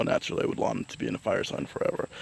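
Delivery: fast, soft whisper; whispered aside. Real, not acted. Astrologer confiding to viewers, quick whisper. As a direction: let it slip as a secret.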